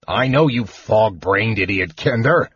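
gruff dwarf's restrained roar; exasperated roar. A narrator's reading. Dwarf to kender as enemy charges, exasperated roar. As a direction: berate him with gruff exasperation. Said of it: "Flint roared".

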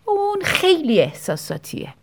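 mock contempt, drawn out; with disgust. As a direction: sneer it out like a disgusted relative. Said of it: "با نفرت گفتن".

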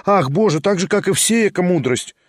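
lightly voiced young woman, wistful then eager; a hint of a lively young lady, lifted a little; young lady Liza. A narrator's reading. Liza dismissive.

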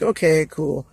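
lightly voiced laid-back guy, unbothered; a hint of a super chill friend shrugging it off; mellow Fanga impression. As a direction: toss it off like nothing fazes you. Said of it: "real chill".

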